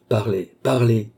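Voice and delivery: slow, insistent command; increasingly imperative. A narrator's reading. The count orders the sleeper to speak, imperative. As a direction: press harder on the repeated word. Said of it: "d'un ton de plus en plus impératif".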